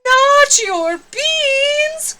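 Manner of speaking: very high, loud, slow outraged wail; stretched-out shocked protest; outraged cry. Acted. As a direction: howl it in horror. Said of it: "cried Scipito, outraged".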